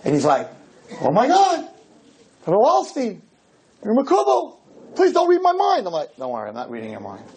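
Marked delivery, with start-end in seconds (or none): 1.0-5.9 high-pitched awestruck pleading; imitated shock, voice jumping way up. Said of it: "so the guy's shocked". 6.2-7.2 soft, low and quick; quick hushed aside to a frightened man; offhand reassurance. Retold or imitated. Calms the shocked man.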